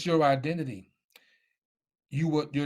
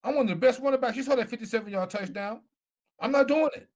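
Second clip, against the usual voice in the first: defiant refusal; 8 semitones higher than usual. Retold or imitated. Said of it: "I could have been like".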